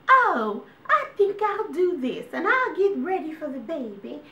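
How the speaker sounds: very high, chirpy doting mom voice; sweet ideal mom. Retold or imitated. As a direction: play the perfect caring mom. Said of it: "would have said".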